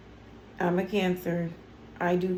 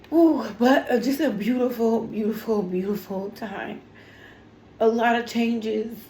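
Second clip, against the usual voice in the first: happy sobbing, voice breaking; overwhelmed joyful tears; real tears, words breaking up; crying; 3 semitones higher, 4 dB louder than usual. Real, not acted. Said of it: "see me crying like this".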